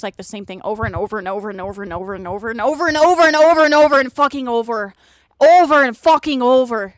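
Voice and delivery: fast, rising into a loud angry outburst; angry rant. Real, not acted. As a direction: let the frustration boil over. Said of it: "It's making me mad".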